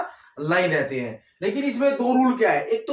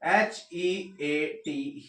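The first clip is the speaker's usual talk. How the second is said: slow spelling; 4 semitones lower, 5 dB quieter, much slower than usual. Shown as a demonstration. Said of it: "spelling out heat".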